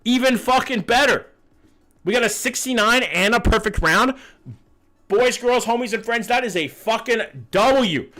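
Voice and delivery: high, excited streamer cheer; excited celebration. Real, not acted. Streamer hyping the chat over a perfect round, excited.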